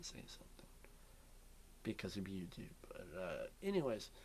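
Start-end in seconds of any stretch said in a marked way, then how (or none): 0.0-0.7 whisper. Real, not acted. Can't say it because of YouTube.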